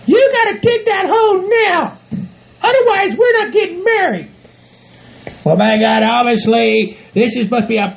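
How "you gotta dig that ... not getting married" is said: high falsetto ultimatum; threatening bossy partner. Acted. Bride threatening to call off wedding, bossy. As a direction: threaten him in a high put-on voice.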